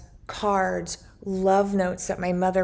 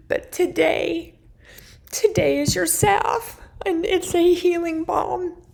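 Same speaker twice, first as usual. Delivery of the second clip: higher, choked-up, rising to a fervent declaration; tearful prophetic word; 6 semitones higher than usual. Retold or imitated. Speaking the Lord's words over her grieving sister, tearful and fervent. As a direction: deliver a prophecy through tears. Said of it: "the Lord spoke to me for her and said".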